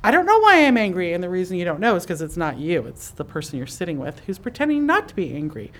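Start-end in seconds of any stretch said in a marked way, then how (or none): 0.0-1.1 higher, exasperated self-talk; mock frustration at yourself, pitched up; frustrated inner voice. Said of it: "we tend to say".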